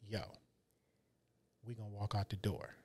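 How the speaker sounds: low, hushed retold aside; imitated whisper. Partner confiding to her at the dealer's table, hushed. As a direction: murmur it under your breath, like a secret tip. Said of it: "So I'm whispering to her".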